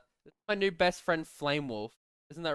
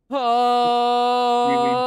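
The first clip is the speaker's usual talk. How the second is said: long, loud, raised exasperated groan; 5 semitones higher, 8 dB louder than usual. Real, not acted. Streamer stuck in game hell, loud frustrated groan.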